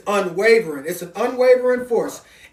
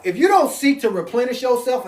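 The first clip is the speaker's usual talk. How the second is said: normal talking voice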